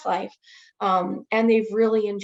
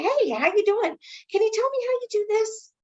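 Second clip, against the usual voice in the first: much higher, sing-song friendly voice; bright high chirpy greeting; 11 semitones higher than usual. Retold or imitated. Greeting nursing assistant in rounds.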